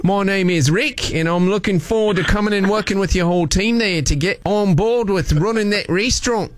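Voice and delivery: broad put-on Aussie accent; Australian accent. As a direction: greet them in a hammed-up Australian accent. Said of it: "using my Australian accent".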